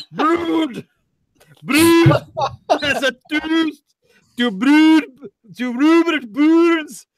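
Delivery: very high, slow over-the-top Scots; butchered Scottish accent. Acted. Hamming up the beer label for co-hosts, squealed Scottish accent. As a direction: stretch every word in absurd Scottish. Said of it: "It got better by the end".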